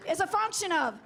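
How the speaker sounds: loud, high call to the crowd; rally the room with it; shouting call-out. Real, not acted.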